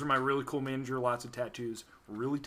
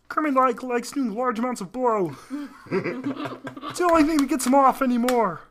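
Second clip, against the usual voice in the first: a hint of the frog voice, higher; lightly voiced Kermit; 7 semitones higher, 6 dB louder than usual. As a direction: keep a trace of Kermit while laughing. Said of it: "Kermit the Frog here".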